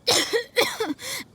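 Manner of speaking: strained cough from illness; a pained fit of coughing; sick cough. Acted. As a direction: cough through her weakness. How did they know about Jean's condition.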